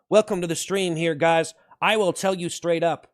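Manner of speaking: ordinary delivery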